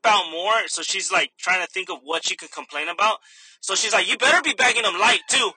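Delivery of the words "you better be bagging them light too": louder, higher bossy customer; mock demanding. Customer orders cashier about bagging them light, mock demanding. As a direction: imitate a customer giving orders.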